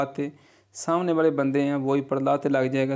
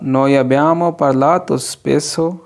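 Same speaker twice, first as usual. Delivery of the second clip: very slow, each Italian word laid out clearly; slow model reading; 9 dB louder, much slower than usual. Shown as a demonstration. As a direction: read it slowly for learners to copy. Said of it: "teacher models Italian sentence". (धीरे-धीरे साफ़ उच्चारण)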